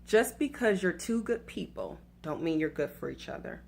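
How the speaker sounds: a quiet, weighty repeat; repeating the key line softly for emphasis. Shown as a demonstration. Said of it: "I'm gonna say that again".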